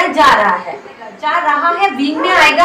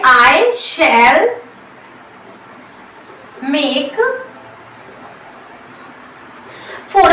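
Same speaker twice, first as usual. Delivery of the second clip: word by word with long pauses between; slow dictation; much slower than usual. Shown as a demonstration. Teacher dictating the example to her class, slow and spaced. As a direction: spell the sentence out for students to write. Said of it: "हम simply बोलते हैं कि".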